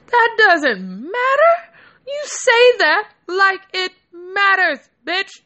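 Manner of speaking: high, slow, whining complaint; half-crying protest, hammed up; mock outraged whine. Outraged at friend's remark.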